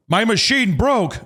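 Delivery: high, slow mock-frantic excuse; frustrated protest. Trenching worker insisting to an angry customer, mock frustrated. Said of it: "the guy is also frustrated".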